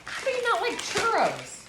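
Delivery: high disbelieving question; shocked, pitch jumping up; surprised. Real, not acted. Shocked at husband's doubt.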